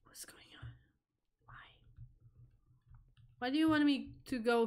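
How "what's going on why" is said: breathy whispered question. Real, not acted. Alone in the house at night, whispering. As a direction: whisper it so nothing hears you. Creeping through dark house.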